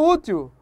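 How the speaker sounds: "útil" ending in a U glide; L sounded as U. Shown as a demonstration. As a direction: say "útil" as "útiu". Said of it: "o L tem som de U". ("útil" com L soando como U)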